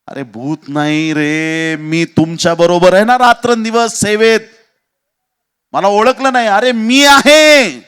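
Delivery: drawn-out call building to a high, loud shout. Acted. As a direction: call across the water, rising to full voice. Jesus calls out to frightened disciples.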